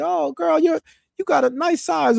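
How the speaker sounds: high, sing-song imitation of women chatting; mimicking women. Mocking women asking a friend in high voice. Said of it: "they'll be like".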